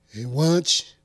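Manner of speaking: a hint of Trump talking to his watch; Trump impression. Talking to his watch, light Trump impression.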